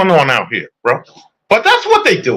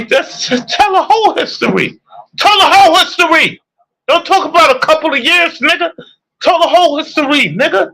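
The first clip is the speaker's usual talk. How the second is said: heated demand; 10 semitones higher than usual. Real, not acted.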